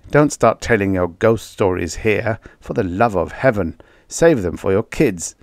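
plain reading voice

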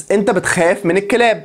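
usual speaking voice

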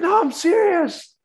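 very high, loud, slow insistence; insisting. Retold or imitated. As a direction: plead that you really mean it. Insisting he is not joking.

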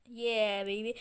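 normal talking voice

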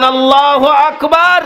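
high, rising rallying cry; loud takbir. Shown as a demonstration. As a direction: call it out high for the crowd to echo. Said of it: "উচ্চ কণ্ঠে বলেন".

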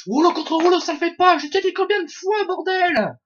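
high-pitched exasperated yelling; angry shout. Real, not acted. Gamer yelling at his teammates, high angry shout. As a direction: scold them at the top of your voice.

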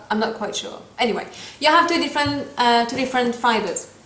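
ordinary delivery

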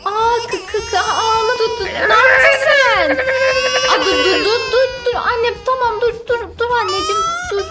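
frightened high pleading, crying out; panicked pleading. Real, not acted. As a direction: beg him to stop, half crying. Friend panics at the act.

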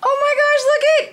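very high, loud excitement; real burst of delight; thrilled squeal. Real, not acted. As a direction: squeal it at the top of your range. Spots a hoped-for gift.

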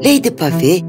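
ordinary delivery, nothing marked

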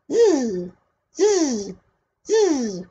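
high buzzing arrow sound. A narrator's reading. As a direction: buzz like flying arrows. Arrows flying.

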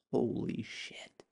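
very slow, hushed disbelief; stunned whisper. Retold or imitated. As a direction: whisper it slowly, as her appalled reaction. Ex-wife's stunned reaction, acted.

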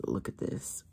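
soft awed whisper. Real, not acted. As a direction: barely breathe it out. Whispering at swatch.

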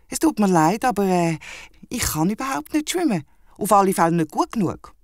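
sheepish wombat. Acted. The wombat confesses to Henry he can't swim, high and sheepish. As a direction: admit it shyly in a squeaky voice.

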